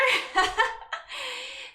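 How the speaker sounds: high laugh. Real, not acted. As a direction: burst of high-pitched laughter. Laughing at a teasing viewer.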